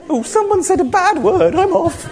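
high, flighty, offended voice; mocking a touchy Holy Spirit, high and prissy; flitty ghost. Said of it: "flitty ghost".

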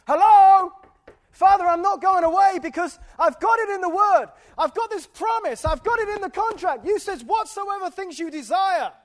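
loud, high, stubborn calling out; insistent calling to God. Retold or imitated. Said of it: "I knock on that door".

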